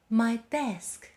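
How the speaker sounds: usual speaking voice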